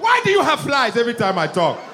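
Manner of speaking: put-on high, indignant African accent; complaining African interviewee. Interviewee complains to news crew, indignant African accent. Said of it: "He's trying to talk".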